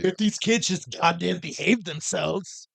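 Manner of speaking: high, loud, drawn-out exasperation; exasperated adult. Acted. Worker fed up with kids, mock-exasperated. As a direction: stretch it out at the end of your patience.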